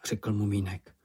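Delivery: ordinary delivery, nothing marked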